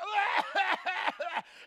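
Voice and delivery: long hammed-up hacking cough; exaggerated, obnoxious coughing; fake coughing fit. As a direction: cough on and on for the skit. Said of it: "he can't stop coughing".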